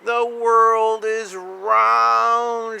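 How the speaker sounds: slow, high, amazed exclamation; exclaiming. Acted. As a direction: stretch it out in pure wonder. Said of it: "she exclaimed".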